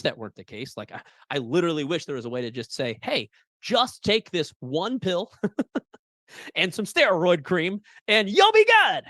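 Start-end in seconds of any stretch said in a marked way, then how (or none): 3.0-9.0 mock doctor voice. Mocking quick-fix doctor advice.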